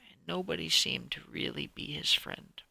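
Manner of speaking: ordinary delivery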